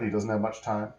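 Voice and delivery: ordinary delivery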